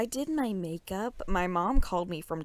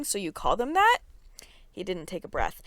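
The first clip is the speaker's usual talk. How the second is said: usual speaking voice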